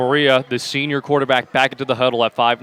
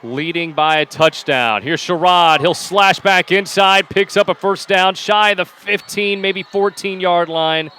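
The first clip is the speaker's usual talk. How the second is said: high, rising call of the run; live play-by-play; 5 semitones higher, 4 dB louder, slower than usual. Real, not acted. Announcer calls Sherrod's run to the first down, excited play-by-play. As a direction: call the play as it unfolds, pitched up.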